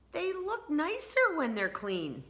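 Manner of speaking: lightly voiced pleased girl; Tina voice. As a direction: say it like a delighted little girl. Tina admires clean socks.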